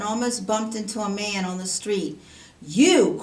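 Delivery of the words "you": a sharp, loud accusing shout; loud cry. A narrator's reading. Servant spots his debtor and shouts loudly. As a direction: call him out hard. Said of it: "cried the servant".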